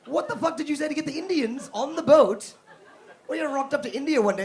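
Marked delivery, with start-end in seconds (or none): none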